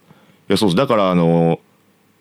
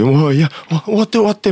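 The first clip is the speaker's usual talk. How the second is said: pleading to finish; 8 semitones higher than usual. Retold or imitated. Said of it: "みたいな感じだったけど".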